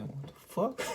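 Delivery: high, startled what the fuck; shocked squeak of disbelief. Retold or imitated. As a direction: jump back in shock. Startled by stranger in his face.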